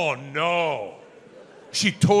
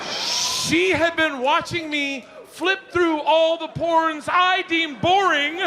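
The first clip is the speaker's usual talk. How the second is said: higher, slow, drawn-out mock shout; mock incredulous; 11 semitones higher, slower than usual. Comedian recalling the wife's discovery, slow high mock outrage.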